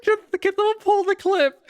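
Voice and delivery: high breathless laughing plea; barely holding it together; laughing hard. Real, not acted. Laughing, asks for clip.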